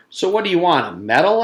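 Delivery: snippy schoolgirl brushing Paul off; sarcastic. Acted. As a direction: scoff at him. Leslie's sarcastic retort.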